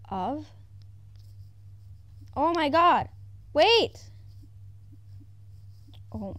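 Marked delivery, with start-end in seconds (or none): 2.4-4.1 high, loud sudden shriek; shrill shocked wait; startled shriek. Real, not acted. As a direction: jump out of your skin. Sudden scare in game.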